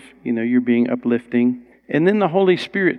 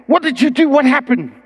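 much higher, startled questions; astonished young man; 11 semitones higher, 3 dB louder than usual. Retold or imitated. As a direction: blurt it out stunned. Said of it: "he was like".

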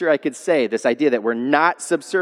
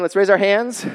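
normal talking voice

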